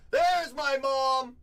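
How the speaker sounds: slow, high, loud drawn-out welcome; excited greeting. Real, not acted. Breaker greeting his mom joining the stream, delighted drawn-out welcome. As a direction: stretch the greeting out gleefully.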